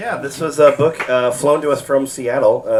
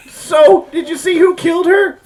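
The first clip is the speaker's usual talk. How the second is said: put-on high girlish voice; 4 dB louder than usual.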